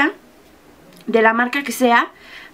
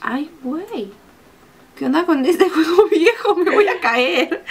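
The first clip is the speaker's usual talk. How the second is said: laughing mock fear; 4 semitones higher, slower than usual. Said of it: "Y es así de".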